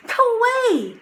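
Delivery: high, loud yell; shouting. A narrator's reading. Jack chasing the wolves off, loud high shout. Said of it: "he shouted".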